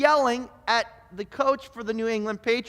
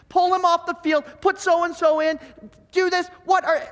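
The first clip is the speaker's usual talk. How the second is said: higher and louder barked coaching calls; armchair coach yelling; 7 semitones higher, 3 dB louder than usual. Retold or imitated. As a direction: shout plays at the screen like you're the coach. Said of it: "And I'll start to yell at the T V".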